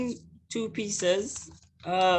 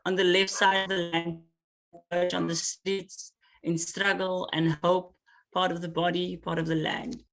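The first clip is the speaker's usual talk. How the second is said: poem reading. Acted. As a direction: weigh every word like an offering. Poet reads her poem aloud.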